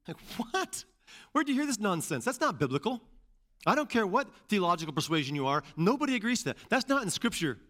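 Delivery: high-pitched, incredulous protest; indignant disbelief. Retold or imitated. Preacher rebutting a man who says he's not elected, indignant. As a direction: push back in baffled outrage, pitched high. Indignant retort to man's claim.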